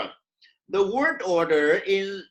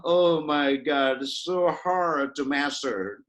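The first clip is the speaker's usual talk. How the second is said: slow, lower groan of complaint; mock despair; 6 semitones lower, slower than usual. Teacher voicing English learners facing tenses, mock despair. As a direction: lament it slowly like an overwhelmed learner.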